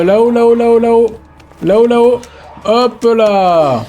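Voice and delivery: high, loud rapid repetition; urgent high-pitched calls; frantic urging. Real, not acted. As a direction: point the escape out in a panic. Panicked escape up in the game.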